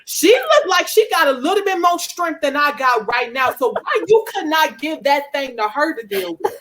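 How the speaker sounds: high, half-laughing complaint; whining complaint to God. Retold or imitated. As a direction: plead with God, half joking. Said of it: "I think about".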